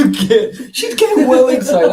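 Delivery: laughing loudly through the words; loud giggly teasing. Real, not acted. He laughs loudly while teasing his excited partner.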